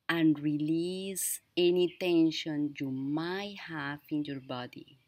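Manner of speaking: slow, deliberate meditation-guide voice; guided breathing exercise. Acted. Teacher inviting viewers to breathe in and release tension, slow guiding voice. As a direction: lead each breath slowly, leaving long pauses.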